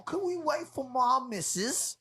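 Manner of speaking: high and slow British voice; British bloke impression. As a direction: ask it like a smug Londoner. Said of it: "he's all".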